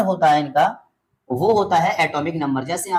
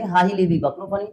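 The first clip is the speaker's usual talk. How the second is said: faster. Shown as a demonstration. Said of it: "अब थोड़ा सा स्पीड बढ़ा देंगे".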